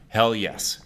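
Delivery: usual speaking voice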